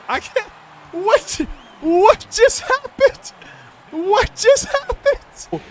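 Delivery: high, slow, stunned question; can't believe what he just saw; real shocked disbelief. Real, not acted. Disbelief after the play.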